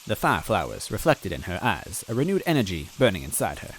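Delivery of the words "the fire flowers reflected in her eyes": ordinary delivery, nothing marked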